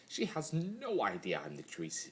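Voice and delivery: usual speaking voice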